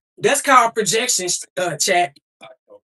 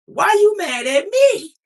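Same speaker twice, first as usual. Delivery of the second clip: high, loud and slow, pleading; a loud, stretched, disbelieving plea; exasperated cry; 9 semitones higher, 6 dB louder, slower than usual. Retold or imitated. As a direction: throw the question up loud like a hurt kid. Imitating her younger self.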